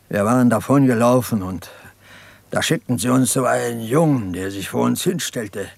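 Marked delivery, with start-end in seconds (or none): none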